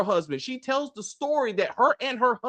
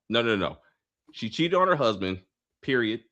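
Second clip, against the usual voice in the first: ordinary talk, nothing marked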